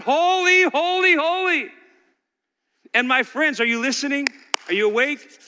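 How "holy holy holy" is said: high, loud proclaiming cry; crying out holy. Retold or imitated. Pastor voicing seraphim crying out. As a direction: ring it out like angels calling. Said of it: "they're crying out".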